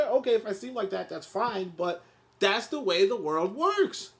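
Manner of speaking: usual speaking voice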